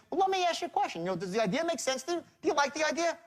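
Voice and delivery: high-pitched, light sales question; disarming tone. Shown as a demonstration. Salesman asks a hesitant prospect, high and disarming. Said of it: "But with tonality, we disarm somebody".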